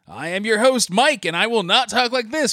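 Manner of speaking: big booming show host intro; announcer voice. Acted. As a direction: pitch it high like a game-show announcer. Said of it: "Was that, like, your announcer voice?".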